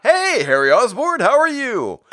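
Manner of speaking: high-pitched, overdone cheery hello; mock stilted greeting. Shown as a demonstration. Reviewer mocking the comic's full-name dialogue, high exaggerated greeting. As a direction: exaggerate it into an absurdly formal greeting. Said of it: "Nobody talks like that".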